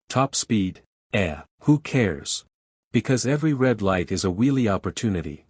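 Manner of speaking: ordinary talk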